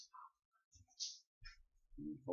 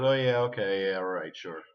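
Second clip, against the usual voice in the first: dismissive; 9 dB louder than usual. Retold or imitated. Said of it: "Everybody goes".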